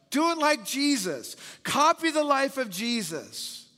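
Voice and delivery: high, ringing preacher's exhortation; preaching call to copy Jesus; emphatic preaching. Real, not acted. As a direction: lift it up and urge them. Preaching climax, high and loud.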